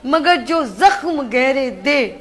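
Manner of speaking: slow, heartfelt verse recital; poetry recitation. Acted. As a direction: recite a farewell couplet with feeling. Anchor's farewell couplet. (جذباتی انداز میں شعر پڑھنا)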